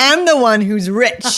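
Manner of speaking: slow, loud, emphatic mock dad retort; Bill Cosby route. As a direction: play a father bluntly putting a child down. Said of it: "gone the Bill Cosby route".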